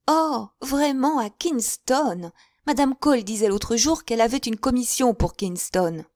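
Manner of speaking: high, eager, fluttering spinster voice; chattering Miss Bates. A narrator's reading. Miss Bates gushing to her guests, high and eager.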